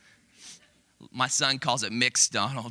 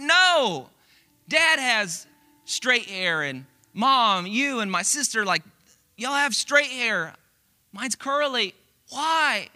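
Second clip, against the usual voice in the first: put-on high, louder toddler voice, slow and whiny; upset toddler; 9 semitones higher, 5 dB louder, slower than usual. Toddler complaining to parents about her curls, high whiny child voice. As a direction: play a two-year-old complaining about her hair. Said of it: "And she's like".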